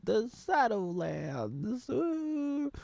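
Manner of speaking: high, slow eerie voice; playfully eerie naming; mock spooky reveal. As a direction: breathe it out like a creepy omen.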